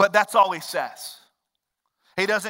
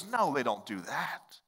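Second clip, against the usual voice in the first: ordinary talk, nothing marked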